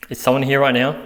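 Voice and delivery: loud, tense call. Real, not acted. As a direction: call out nervously. Calling out uneasy.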